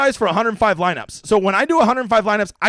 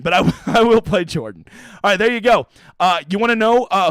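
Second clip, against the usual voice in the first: ordinary talk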